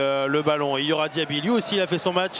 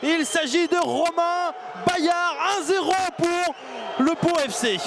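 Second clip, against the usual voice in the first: excited goal call; 7 semitones higher than usual. Real, not acted. Goal called by commentator.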